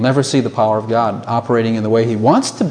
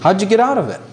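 normal talking voice

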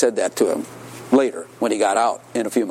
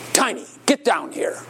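higher-pitched mock tough command; roughest voice; 8 semitones higher than usual. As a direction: order him down in an imitated rough voice. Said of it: "he says in his roughest voice".

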